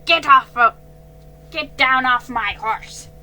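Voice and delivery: loud, slow angry rant; angry scolding. A narrator's reading. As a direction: scold the thief furiously. Angry owner scolding the girl.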